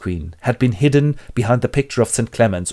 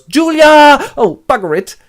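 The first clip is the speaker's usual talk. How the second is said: much higher, loud cry; calling Julia; 10 dB louder, slower than usual. Acted. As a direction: yell her name. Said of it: "in a dream he goes".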